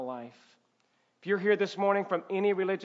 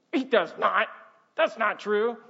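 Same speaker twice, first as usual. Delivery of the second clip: slightly raised, argumentative retort; mock indignant protest; 4 semitones higher, 5 dB louder than usual. Pretend spouse arguing back when told to listen, mock indignant. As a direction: play a spouse disputing it.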